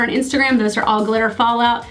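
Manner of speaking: normal talking voice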